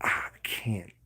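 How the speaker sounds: a breathy whisper; hushed whisper, almost no voice. Shown as a demonstration. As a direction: barely breathe it out. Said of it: "some people could be like".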